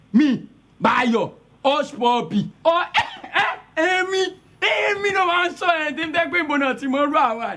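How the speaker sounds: high, loud bragging; high-pitched boasting, almost squealing; boastful show-off. Acted. Boasting, showing off loudly.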